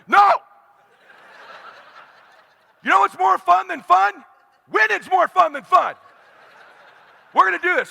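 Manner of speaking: very high, loud shouted correction; fierce shouting. Retold or imitated. Dad overruling his son's talk of fun, shouting. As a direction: yell it like a fired-up coach. Father yells at protesting son.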